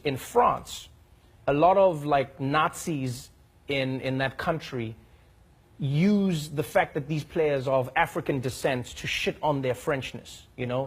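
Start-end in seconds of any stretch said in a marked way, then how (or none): none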